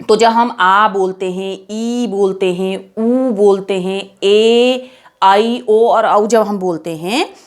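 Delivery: slow, with आ, ई, ऊ stretched long; teacher stretching long vowels; drawn-out long vowels. Shown as a demonstration. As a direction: draw each long vowel out slowly. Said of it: "इसमें हमारा ज़्यादा समय लगता है".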